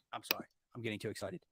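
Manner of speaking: low, near-whispered aside; hushed apology. Real, not acted. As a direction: drop right down and rein yourself in. Reins himself in after rant.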